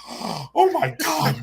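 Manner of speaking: high, drawn-out mock horror; mom's aghast reaction. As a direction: stretch it out slowly in a high, horrified mom voice.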